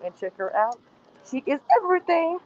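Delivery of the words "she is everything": Jasmine voice. Pretend. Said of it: "And my Jasmine voice".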